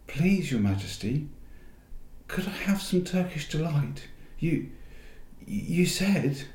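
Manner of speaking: put-on high, timid young boy asking; pleading boy voice. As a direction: beg her meekly like a boy. Edmund begs the witch.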